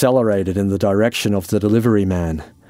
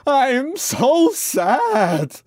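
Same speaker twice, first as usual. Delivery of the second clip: even higher, long-drawn wail; wailing howl; much slower than usual. Acted. Francis sobs into his hands, howling. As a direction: howl it out slowly in despair. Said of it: "he howled".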